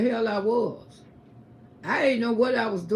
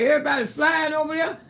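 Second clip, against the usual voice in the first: ordinary talk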